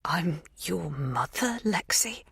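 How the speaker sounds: slow soft whisper; hushed. A narrator's reading. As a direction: breathe it out gently. Magda reveals herself as mother.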